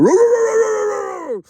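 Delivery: high, loud mock beast roars; animal roar. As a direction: roar like a wild animal to scare a friend. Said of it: "so I start going".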